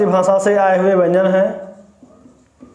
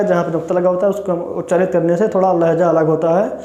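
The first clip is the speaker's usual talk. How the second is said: ordinary delivery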